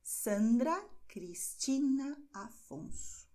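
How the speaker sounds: Sandra Cristina Afonso, slow and even; slow repetition. Shown as a demonstration. Teacher repeats the examples for students, slow. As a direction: repeat the three names slowly for learners. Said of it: "Vou repetir".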